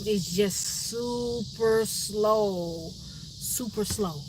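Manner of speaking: very slow, stretched-out country drawl; super slow drawl. Shown as a demonstration. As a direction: drag each word out to show how slowly they talk. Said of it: "it's like a drawl".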